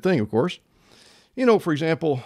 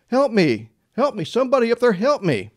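high-pitched calls for help; mock yell for help; 8 semitones higher than usual. Fallen man calling up from the bush for help, high mock yell. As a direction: play a light, high cry for help. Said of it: "He began to yell for help".

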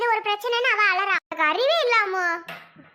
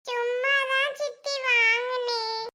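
put-on slow, whining child in tears; tearful child; 3 semitones higher, 3 dB quieter, much slower than usual. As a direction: draw the excuse out through tears. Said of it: "இதுக்கு போய் இப்படி அழுகுற?". (அழுகைக் குரலில் இழுத்துப் பேசும் குழந்தை)

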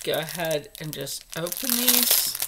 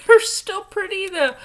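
laughing it out, much higher and louder; 13 semitones higher, 11 dB louder than usual. Real, not acted. Laughing to viewers about the odd earrings, higher and louder. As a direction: bubble over with a laugh.

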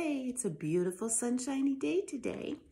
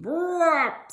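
machine noise; 5 semitones higher, 10 dB louder than usual. Acted. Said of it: "said the planing machine".

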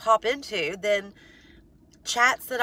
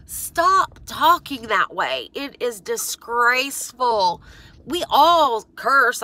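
slow firm scolding; 4 dB louder, much slower than usual. Real, not acted.

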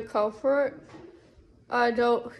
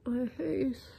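whispering; 8 dB quieter, slower than usual. Real, not acted. Showing face to camera.

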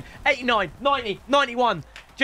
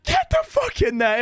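excited shout. Real, not acted. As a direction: punch the air and shout it. Celebrating sale.

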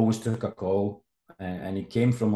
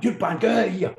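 mock furious yell; 11 semitones higher, 5 dB louder than usual. Manager throwing him out.

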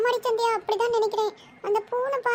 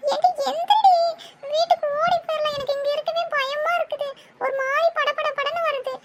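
higher, frantic begging with a nervous laugh; higher, scared urgent plea; panicked pleading; 5 semitones higher than usual. Acted. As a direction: beg her to get up and run home. Panicked girl begs friend to run.

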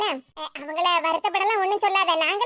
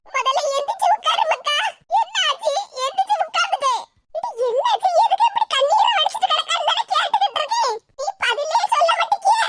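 fretting aachi, voice pitched up and pleading; worried grandmother; 11 semitones higher than usual. Acted. Grandmother fussing over the sobbing girl, high anxious call.

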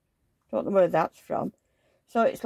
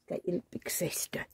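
whispering; 10 dB quieter than usual. Real, not acted. Fetching dolls quietly.

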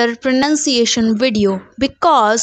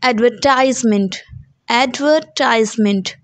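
American advertisement as ad-ver-TIZE-ment; American pronunciation. Shown as a demonstration. Teacher contrasting for students, American pronunciation. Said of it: "the American pronunciation is".

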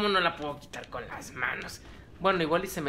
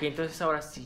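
ordinary delivery

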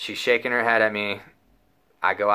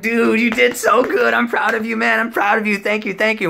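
giddy praise; 12 semitones higher, 6 dB louder than usual. Retold or imitated. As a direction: gush over him, voice way up. Praising brother after cop leaves.

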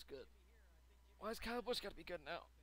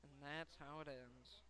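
slow, soft admission of defeat; resigned; 3 semitones lower, 8 dB quieter, slower than usual. Real, not acted. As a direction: let it trail out quietly, giving up. Resigned as race is lost.

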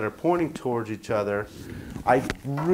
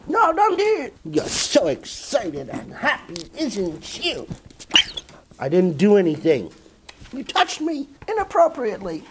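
very high, squeaky put-on voice for the dog; playful squeaky voice for the dog; voicing the pet; 9 semitones higher than usual. Voicing the dog's reply.

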